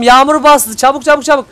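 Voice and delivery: loud, high, urgent call; urgent hurrying. Real, not acted. Gamer hurrying the team as rain starts, urgent.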